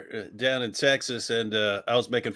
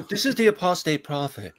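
higher, lightly put-on show intro; channel host impression; 4 semitones higher than usual. Co-host corrects the host's intro, lightly imitating it. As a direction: mimic a host's intro, pitched up. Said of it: "It's supposed to be".

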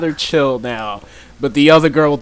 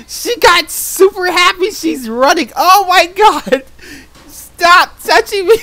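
high, louder squeals, slowing on "Oh, my God"; squealing panic; 6 dB louder than usual. Real, not acted. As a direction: squeal while being chased down. Player chasing and grabbing him.

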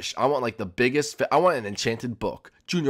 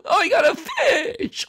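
mock surprise; 3 dB louder, slower than usual. High-pitched mock surprise.

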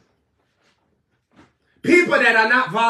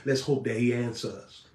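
much lower, softer limp prayer; mock timid leader; 12 semitones lower, 8 dB quieter than usual. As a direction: mimic a weak spiritual leader. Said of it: "people leading people talking about".

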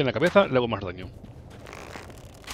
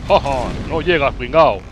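burla; 7 dB louder, slower than usual. Real, not acted. Burla al enemigo en el juego.

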